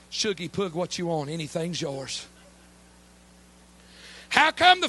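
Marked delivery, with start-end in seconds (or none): 0.1-2.3 tender pet name. Acted. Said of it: "You know what it literally seems like he says".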